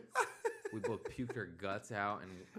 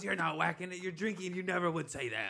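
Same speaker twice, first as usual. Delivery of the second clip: high, insistent mom voice; imitated mom; 8 semitones higher, 3 dB louder than usual. Mom calling out her drunk son, high scolding imitation. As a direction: argue back in a raised, higher pitch.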